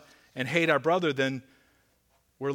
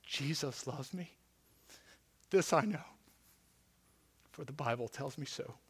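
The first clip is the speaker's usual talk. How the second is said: simple quiet answer; 11 dB quieter than usual. Retold or imitated. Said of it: "Karl Barth looked up and said".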